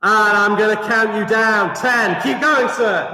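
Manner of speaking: ordinary talk, nothing marked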